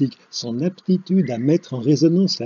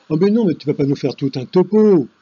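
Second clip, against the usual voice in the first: exasperated interruption; 7 dB louder, faster than usual. Acted. Cutting off a colleague's lecture.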